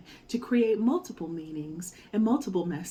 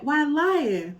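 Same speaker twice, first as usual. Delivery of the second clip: high, louder teasing monkey voice; mocking monkey; 6 semitones higher, 7 dB louder than usual. A narrator's reading. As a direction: tease him from up in the tree. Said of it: "he said".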